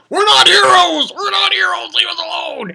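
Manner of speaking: high-pitched mock yell of protest; Luffy yelling. Pirate rejects the soldiers' thanks, high yelling. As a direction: shout it way up high, imitating an exasperated hero. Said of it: "he's yelling".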